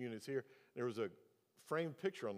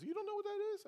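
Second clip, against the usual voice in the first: imitating the owner; faster than usual. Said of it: "He goes".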